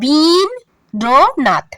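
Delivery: বিন র নাথ split into separate beats; syllable by syllable. Shown as a demonstration. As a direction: break রবীন্দ্রনাথ into বিন, র, নাথ with pauses. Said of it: "উচ্চারণ করতে হবে". (দলে দলে ভেঙে উচ্চারণ)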